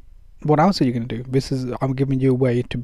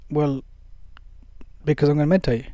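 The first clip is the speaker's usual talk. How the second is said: matter-of-fact; 4 dB quieter, faster than usual. Shown as a demonstration. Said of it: "you say as a tactic".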